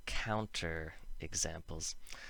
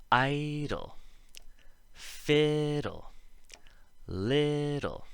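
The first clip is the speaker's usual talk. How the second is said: "Idle, fiddle, little" with first vowels high, loud and held; exaggerated first-syllable stress on "Idle", "fiddle", "little"; emphasized first vowel; 7 semitones higher, 4 dB louder, much slower than usual. Shown as a demonstration. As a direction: stretch the first syllable of "fiddle", drop on the second. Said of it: "more examples".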